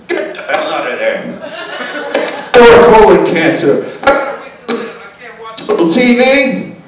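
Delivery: hammed-up cranky old patient; grumpy old man. Old man confronting someone in his seat, grumpy old-man voice. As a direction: grouse loudly, in bursts. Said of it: "some old man was gonna come".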